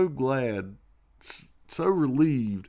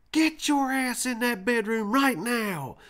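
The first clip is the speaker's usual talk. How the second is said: much higher, slow angry command; furious grandma; 13 semitones higher, 3 dB louder than usual. Retold or imitated. Grandma flipping out on her grandson, a high slow angry order. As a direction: order the boy to his room, high and hard. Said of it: "she's like".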